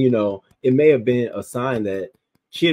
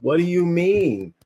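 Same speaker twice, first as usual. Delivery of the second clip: much higher, slow incredulous question; can't believe it; disbelief; 9 semitones higher, 5 dB louder, slower than usual. Real, not acted. Reacting to the baby being shaken, high slow disbelief.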